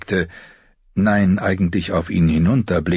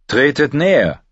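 king calls the victor over, higher and louder; calling out; 7 semitones higher, 4 dB louder than usual. A narrator's reading. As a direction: summon him across the field. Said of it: "rief König Renly dem Sieger zu".

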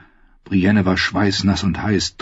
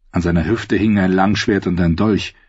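usual speaking voice

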